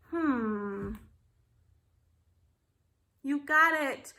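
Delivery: normal talking voice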